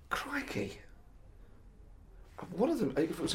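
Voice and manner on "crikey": whispering. Real, not acted. Stumped by the question.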